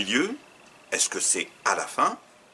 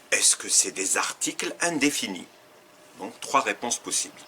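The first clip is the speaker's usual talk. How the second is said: ordinary talk, nothing marked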